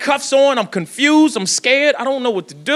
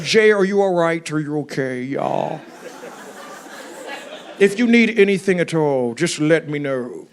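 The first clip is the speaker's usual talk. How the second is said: Lorne Michaels impression; 7 semitones lower, 3 dB quieter than usual. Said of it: "Lorne Michaels called my cell phone".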